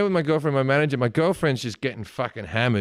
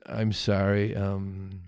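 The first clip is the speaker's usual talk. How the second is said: low, slow, puzzled impression; hesitant, drawn-out polite question; Warren Beatty; 5 semitones lower, 4 dB quieter, slower than usual. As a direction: feel your way slowly into the question. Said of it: "turns into me and he goes".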